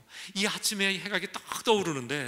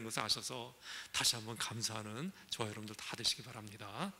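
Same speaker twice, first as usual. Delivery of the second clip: quiet sincere exhortation; 4 semitones lower, 5 dB quieter than usual. Acted. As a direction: gently urge the congregation to give thanks. Soft earnest exhortation.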